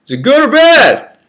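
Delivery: high, loud shouted question; friend shouting. Acted. Friend shouts to the prisoner being taken away, loud call. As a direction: call it out from behind bars. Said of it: "screamed a friend".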